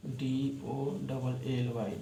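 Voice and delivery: letters read slowly one by one; dictating letters slowly; spelled out slowly. Shown as a demonstration. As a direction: spell the word slowly for the class. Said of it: "Dolly लिखना है".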